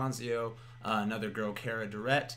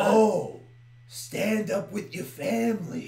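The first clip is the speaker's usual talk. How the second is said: mock heckler; 8 semitones higher, 7 dB louder, slower than usual. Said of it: "like, upset because he was".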